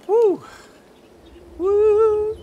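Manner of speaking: very high, loud woo; gleeful shout of woo; excited whoop. Real, not acted. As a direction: cheer the success. Cheering after a jump.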